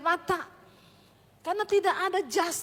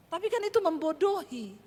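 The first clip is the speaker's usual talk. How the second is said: ordinary delivery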